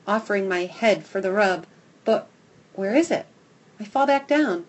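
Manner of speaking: usual speaking voice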